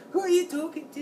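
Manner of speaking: very high, put-on Irish voice; badly faked Irish challenge; bad Irish accent. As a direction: attempt Irish trash talk in falsetto. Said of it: "I can't do an Irish accent".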